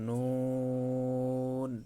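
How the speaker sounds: নুন drawn out very slowly; Arabic letter demo. Shown as a demonstration. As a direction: hold নুন long and clear. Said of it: "তারপর". (ধীরে টেনে নুন উচ্চারণ)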